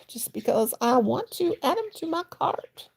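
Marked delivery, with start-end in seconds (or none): 2.0-2.9 "to my cart" veel hoger en zangerig uitgerekt; zing "to my cart" speels omhoog; high sing-song. Acted. Repeats phrase in sing-song.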